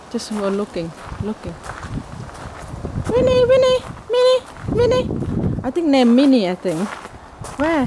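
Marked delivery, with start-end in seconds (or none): 3.1-5.1 calling the cat. Real, not acted. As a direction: call her name up high across the garden.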